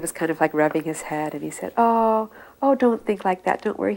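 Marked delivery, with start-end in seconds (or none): 1.8-3.9 comforting guru. Retold or imitated. Guru comforting weeping disciple.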